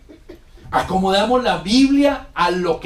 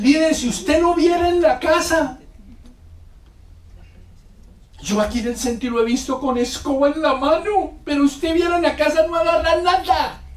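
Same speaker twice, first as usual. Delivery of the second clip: higher, louder imitation of a complaining wife; mock scolding wife; 11 semitones higher, 5 dB louder than usual. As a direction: mimic a wife teasing the leader. Quoting wives scolding leaders. (esposa quejosa imitada, más aguda)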